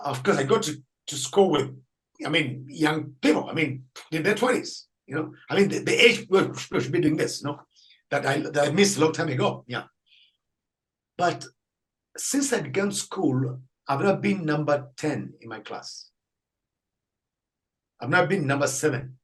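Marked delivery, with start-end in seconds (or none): none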